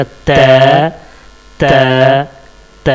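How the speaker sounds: त with the tongue tip touching the root of the upper teeth; correct ta. Shown as a demonstration. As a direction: model the dental त to imitate. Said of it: "तो सही ता निकलेगा". (ऊपर के दांत की जड़ से सही ता)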